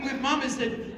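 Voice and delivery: normal talking voice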